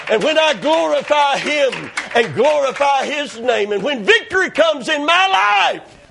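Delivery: mounting pulpit fervour; climactic preaching. Acted. Preacher glorifying God for victory, climactic preaching.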